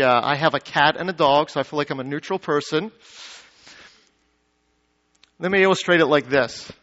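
ordinary delivery

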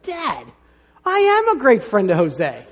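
put-on indignant kid. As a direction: son objecting to dad's prayer, indignant. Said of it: "is indignant".

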